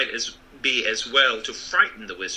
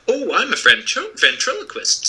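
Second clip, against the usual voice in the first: louder, bright excited reveal; eager boast; 12 semitones higher, 5 dB louder than usual. Acted. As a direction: announce your trick with pride. Wizard proudly explains.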